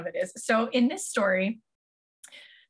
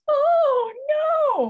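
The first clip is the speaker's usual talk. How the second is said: put-on much higher and louder squeaky kid wail; claymation kid voice; 5 dB louder than usual. Kid sees Krampus coming, high squeaky claymation wail. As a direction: squeal it like a panicked clay child. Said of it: "classic Christmas voice".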